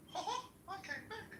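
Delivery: Disney cartoon voice. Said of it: "if Quran was written by Disney".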